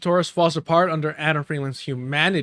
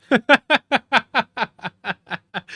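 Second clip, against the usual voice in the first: loud high burst of real laughter; cackling at the screen; laughing; 7 semitones higher, 5 dB louder than usual. Real, not acted. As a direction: crack up at the clip. Reacting to the clip.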